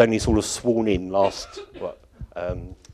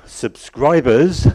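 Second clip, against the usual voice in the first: "subscribers" louder and slowed, leaned on heavily; stress on subscribers; 4 semitones higher, 6 dB louder, much slower than usual. Real, not acted. Speaker plugs the website's members, heavily stressing "subscribers". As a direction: punch "subscribers" slowly and loudly. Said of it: "I'll try to emphasise that word".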